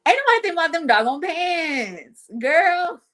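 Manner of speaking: high, hammed-up wail of exasperation; exasperated scolding. Aunt dismissing her wish to look presentable, high and exasperated. Aunt's exasperated comeback about pants.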